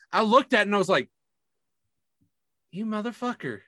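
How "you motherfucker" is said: muttered curse. Retold or imitated. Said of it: "That is not me saying that now".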